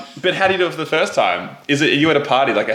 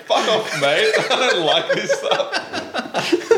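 laughing retort; 7 semitones higher, much slower than usual. Retold or imitated. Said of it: "And I was like".

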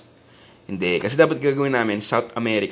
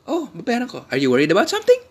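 joking mock reply; 9 semitones higher, faster than usual. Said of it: "joke namin".

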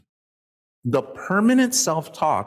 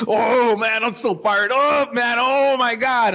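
higher and louder, fired up; hyped-up excitement; 7 semitones higher, 4 dB louder, slower than usual. Retold or imitated. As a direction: gush like you just left a seminar. Acting fired-up seminar attendee.

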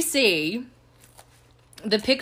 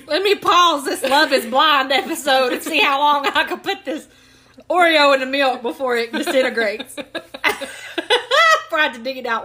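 high, loud excited voice; gleeful self-narration; 6 semitones higher, 5 dB louder than usual. Retold or imitated. Imitating her Oreo experiment.

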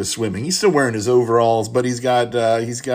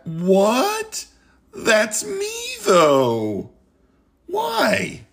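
much higher, squeaky disbelief; mock shock; 11 semitones higher, much slower than usual. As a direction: play shocked at being named. Mock shock at own inclusion.

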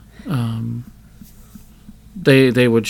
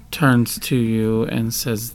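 ordinary talk

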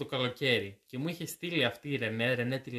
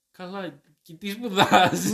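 higher, louder mock disbelief; incredulous question; 6 semitones higher, 13 dB louder, slower than usual. Student mimics everyone asking about his odd course mix, incredulous. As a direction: ask it loudly, voice jumping up in bafflement. Imitates baffled people's reaction to mixed courses.

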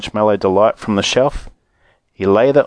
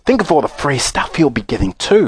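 put-on mildly envious boy; a touch of jealousy; 3 semitones higher than usual. A narrator's reading. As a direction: say it with grudging envy. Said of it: "another boy said enviously".

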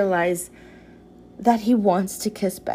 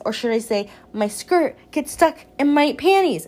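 normal talking voice

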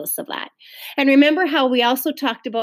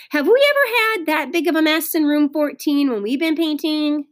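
ordinary delivery

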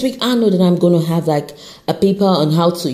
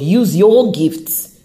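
slow preachy urging; much slower than usual. Real, not acted. Preaching to listeners.

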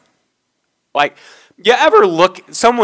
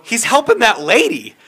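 raised pitch, retold retort; exact mimicked tone; 6 semitones higher than usual. Store worker answering the upset customer, mimicked retort. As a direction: throw the customer's tone back, pitched up. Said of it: "exactly, tone, everything".

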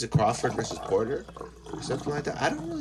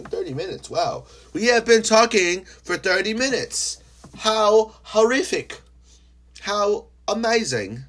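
mock amazement; 8 dB louder than usual. Host marveling at talking thirty minutes, hammed-up surprise. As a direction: gush in fake shock. Mock shock at time passed.

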